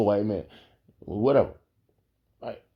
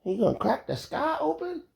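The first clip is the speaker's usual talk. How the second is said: incredulous quote; 3 dB quieter than usual. Retold or imitated. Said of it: "He said something".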